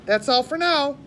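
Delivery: bright goodbye to listeners; cheerful sign-off. Real, not acted.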